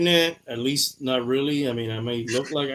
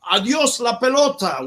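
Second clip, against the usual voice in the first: announcer call; 8 semitones higher, 5 dB louder than usual. Retold or imitated. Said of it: "very famous announcer from here".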